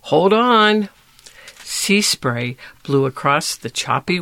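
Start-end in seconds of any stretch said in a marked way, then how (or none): 0.0-0.9 high, louder urgent hold-on; urgent call. A narrator's reading. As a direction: brace your friend as the ship lifts. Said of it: "gasped Box".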